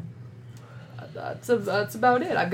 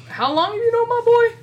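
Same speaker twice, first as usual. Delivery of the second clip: higher, louder probing dad question; sizing up the son's friend; protective dad; 6 dB louder than usual. Acted. Dad questioning Frost about his son, higher and louder.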